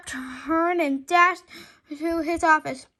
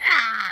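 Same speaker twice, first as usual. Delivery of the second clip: short scream; 6 semitones lower, 6 dB louder than usual. A narrator's reading. Teacher swallowed by toilet.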